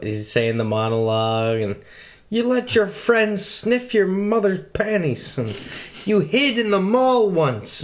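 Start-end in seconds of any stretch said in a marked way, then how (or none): 2.3-7.8 imitating a powerful courtroom voice. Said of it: "how powerful he was".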